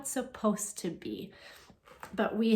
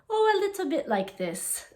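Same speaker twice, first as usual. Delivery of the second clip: lightly put-on Irish accent, higher pitched; mock Irish accent; 9 semitones higher than usual. Teacher to children imitating her dad, mock Irish accent. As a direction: do your dad's Irish accent, made up. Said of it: "not a made-up one like mine".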